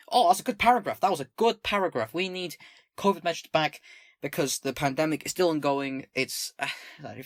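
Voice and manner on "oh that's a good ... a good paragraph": loud, high burst of approval; emphatic approval. Real, not acted. Streamer praising a paragraph just read, excited. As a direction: punch it out, really impressed.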